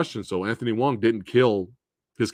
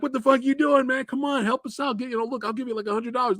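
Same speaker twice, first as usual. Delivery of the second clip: high, desperate begging; pleading husband; 13 semitones higher, faster than usual. Retold or imitated. Husband begging the taxi driver to take his wife, high desperate plea. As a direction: beg the cabbie, pitched way up. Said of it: "And he's like".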